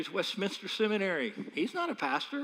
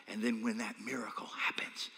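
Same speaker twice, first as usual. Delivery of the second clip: whispered; 7 semitones lower, 8 dB quieter than usual. Acted. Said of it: "preaching softly".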